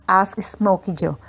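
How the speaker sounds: ordinary delivery, nothing marked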